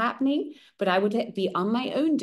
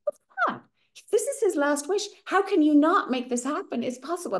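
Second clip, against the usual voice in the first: much higher, exasperated cry of disbelief; shocked outburst; 7 semitones higher than usual. Retold or imitated. Niece hearing the uncle's last wish refused, shocked and high. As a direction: throw the pitch up in dismay.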